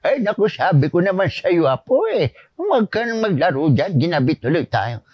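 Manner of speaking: scolding grandfather in a radio drama; Lolo Tasyo. Acted. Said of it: "si Lolo Tasyo".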